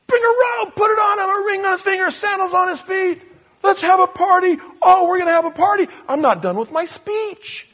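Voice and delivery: high, loud, joyous calling out; jubilant father. Acted. Father cutting off his son's speech, jubilant and loud. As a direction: shout orders for the party. Father interrupts, calls for party.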